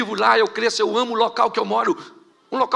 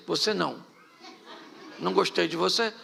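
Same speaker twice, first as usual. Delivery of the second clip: voicing God rejecting, lower and softer; 2 semitones lower, 7 dB quieter than usual. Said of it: "disse assim".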